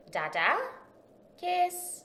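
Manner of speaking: put-on high, loud little toddler voice; baby voice. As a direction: pipe it like a toddler asking for a kiss. Imitating baby daughter's words.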